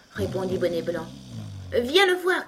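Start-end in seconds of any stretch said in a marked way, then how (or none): 1.7-2.5 high, loud eager call. A narrator's reading. The brothers inviting Alice to come, calling out. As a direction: call out like two excited brothers. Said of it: "crièrent les deux frères".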